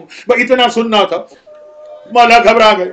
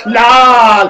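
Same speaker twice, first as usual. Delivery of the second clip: mother's cry; 3 dB louder, much slower than usual. Acted. Mother's cry to her son.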